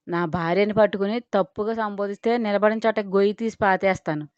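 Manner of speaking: ordinary delivery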